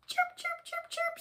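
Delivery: tiny, very high, soft cheeping; squeaky chicks. A narrator's reading. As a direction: squeak it like baby birds. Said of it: "squeaked the chicks".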